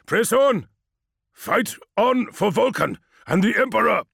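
loud, high rallying shout; rallying battle cry. Acted. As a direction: rouse the troops. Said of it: "Despite his exhortations".

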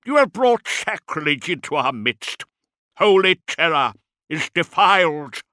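loud, high outraged denunciation; ranting zealot's outrage; screamed accusation. A narrator's reading. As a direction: cry sacrilege at full voice. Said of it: "he screamed at her".

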